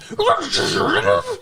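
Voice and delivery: high silly whoosh imitating a crash; cartoon crash noise. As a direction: mimic a goofy cartoon crash sound. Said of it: "It's like a cartoon".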